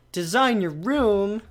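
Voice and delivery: delighted gasp, slow and drawn out; excited gasp. Real, not acted. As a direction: gasp with real delight, then ask. Excited at room design option.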